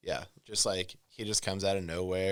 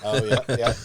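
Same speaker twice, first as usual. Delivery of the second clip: laughing; 4 semitones higher, 11 dB louder than usual. Real, not acted. Laughing along about The Rock.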